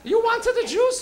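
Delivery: high, loud mother's question; imitated mom. Mom to stoned son about juice, high-voiced. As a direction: pitch it way up as a doting mom.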